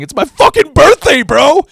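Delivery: high, loud indignant yell; outraged birthday yell; yelled protest. Retold or imitated. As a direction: shout it like the whole street should hear. Yelling his birthday at the cops.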